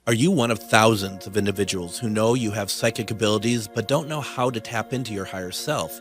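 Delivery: smooth commercial voiceover; ad read. Acted. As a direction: sell it like a radio spot. Recorded ad read.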